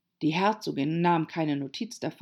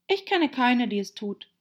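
höflicher, leichter Widerspruch; sehr höflich; 7 semitones higher than usual. A narrator's reading. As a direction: behutsam und artig widersprechen. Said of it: "sagte Alice sehr höflich". (sehr höflich, leicht angedeutet)

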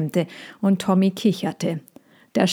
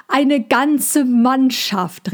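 called out; 6 semitones higher, much slower than usual. A narrator's reading. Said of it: "rief Cassandra".

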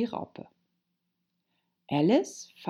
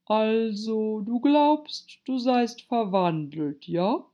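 higher, ponderous caterpillar voice; languid, deliberate interrogation; questioning caterpillar; 6 semitones higher, 4 dB louder, slower than usual. A narrator's reading. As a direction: question sceptically, stretching the words. Caterpillar questions Alice after pause.

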